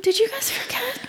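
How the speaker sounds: high, quiet disbelieving laugh; incredulous. Retold or imitated. Retold disbelief at show creator.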